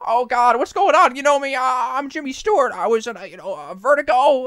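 put-on frantic, high-pitched old-movie actor; hammed-up distressed film-star impression; Jimmy Stewart. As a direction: plead high and halting, mounting in panic. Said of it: "he's talking to the angel".

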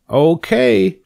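high, keen agreement; eager pig. A narrator's reading. Pig takes up the spider's challenge, eager. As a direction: agree as an excited little pig.

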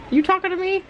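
high teasing pet voice. Real, not acted.

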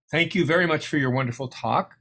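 ordinary delivery

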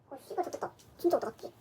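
whispered curse; cursing under breath. Real, not acted. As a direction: mutter the swear under breath. Muttering over the missing bag.

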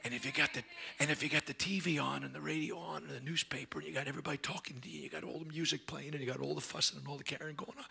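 low, hushed preaching. Real, not acted. Preacher listing noise that drowns God, hushed. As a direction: keep it soft and confiding. Hushed talk of God's quiet voice.

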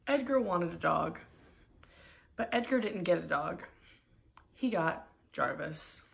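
usual speaking voice

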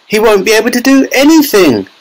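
high-pitched lament; distressed cry. A narrator's reading. Mother laments son's tiny size, high distressed cry. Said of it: "cried Missus T V".

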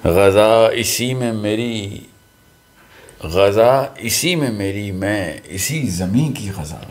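slow, measured Urdu verse reading; ghazal recitation. Real, not acted. Poet's son reciting his father's ghazal to friends, measured recitation. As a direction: recite poetry with weighted pauses.

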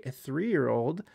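ordinary talk

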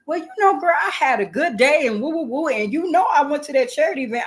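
put-on high-pitched, bubbly girl-talk; acted-out excited catching up; eager chatting. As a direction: share good news with a girlfriend. Acting out eager chat she skips.

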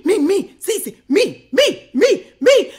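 high, loud, excited mock voice; imitating the coworker bragging; mimicking Oz. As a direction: chant me me like an eager kid.

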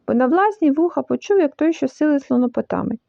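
plain reading voice

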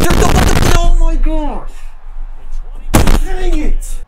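loud, high-pitched rage outburst; real angry yell. Real, not acted. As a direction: scream it in frustration at the game. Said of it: "I'm actually so mad right now".

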